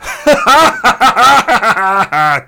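long, loud, high guffaw; laughing. Real, not acted. Podcaster after startling listeners, uproarious laughter. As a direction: cackle loudly at your own prank.

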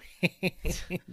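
chuckling at own pun; proud laugh at own joke; corny dad laugh. Real, not acted. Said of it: "I'm done laughing at my own joke".

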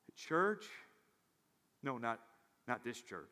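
normal talking voice

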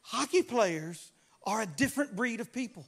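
ordinary talk

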